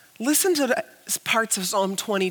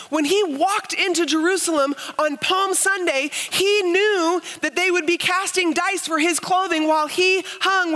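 rising preaching fervour; 6 semitones higher than usual. Acted. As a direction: build the conviction word by word. Preaching climax on Scripture.

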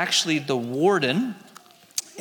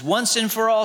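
pleading prisoner; 5 semitones higher, 3 dB louder than usual. A narrator's reading. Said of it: "said the prisoner".

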